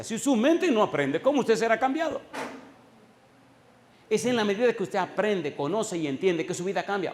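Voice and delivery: high, loud preaching, driving the question home; fiery preaching. Acted. Pastor to congregation, fiery preaching. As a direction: preach it at full force to the congregation. Preacher rising to full force.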